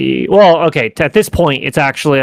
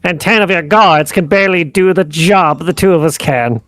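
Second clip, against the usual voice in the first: lightly voiced objecting character, pitched up; Landolf; 7 semitones higher, 4 dB louder than usual. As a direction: object in character, higher and a touch louder. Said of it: "Landolf kinda like".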